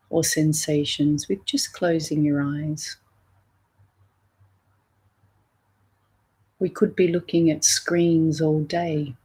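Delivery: guided meditation. Acted. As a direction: gently lead listeners inward, leaving room between phrases.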